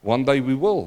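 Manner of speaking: normal talking voice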